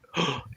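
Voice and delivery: short mock gasp of awe; starstruck gasp. Guy on the phone learns it's Major Jack Reacher, starstruck gasp. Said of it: "acts all starstruck".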